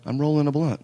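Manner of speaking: half-shouted retold answer to wife; retold yelling. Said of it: "I started screaming".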